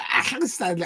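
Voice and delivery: a badly faked Scouse accent on "Accrington Stanley", clumsy and overdone; butchered Scouse accent. Vlogger botches the milk advert kid's Scouse accent. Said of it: "I can't do that accent. I butchered it".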